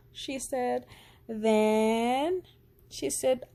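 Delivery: plain reading voice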